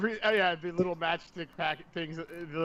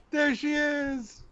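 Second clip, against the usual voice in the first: high, slow delighted squeal; excited; 10 semitones higher, slower than usual. Real, not acted. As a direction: coo at the cat with delight. Greeting the cat excitedly.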